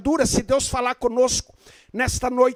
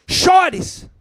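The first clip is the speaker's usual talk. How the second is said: Chores called out louder; exclaimed command; 6 semitones higher, 6 dB louder than usual. Shown as a demonstration. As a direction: throw out Chores as a firm exclamation. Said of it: "Tem lugar que a Bíblia diz".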